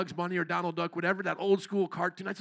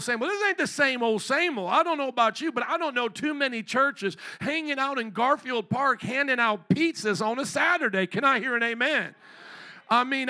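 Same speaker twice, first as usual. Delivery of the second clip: loud, high, fervent preaching; preaching at full force; 4 semitones higher, 5 dB louder than usual. Acted. Pastor hyping the outreach to the congregation, loud and fervent. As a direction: whip up the church.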